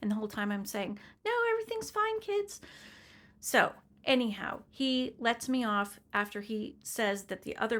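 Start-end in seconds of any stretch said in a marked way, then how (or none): 1.2-2.6 high, singsong mom cover-up; feigned reassurance. Retold or imitated. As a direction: pitch it way up, pretending all is fine. Mother feigns calm to her kids.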